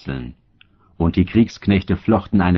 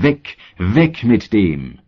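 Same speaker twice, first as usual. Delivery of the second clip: crowd shouting; 2 semitones higher, slower than usual. A narrator's reading. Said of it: "Sie schrien aber".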